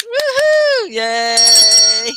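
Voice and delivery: high, loud whooping celebration; gleeful teacher's hooray; excited cheer. Real, not acted. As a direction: cheer them on with a long drawn whoop. Cheering a correctly spelled word.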